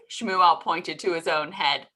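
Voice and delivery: usual speaking voice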